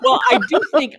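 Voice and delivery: real amused laughter; laughing. Real, not acted. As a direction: laugh before speaking. Laughing at stutter joke.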